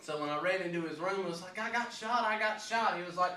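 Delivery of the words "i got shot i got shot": retold alarm. Retold running to granddad for help.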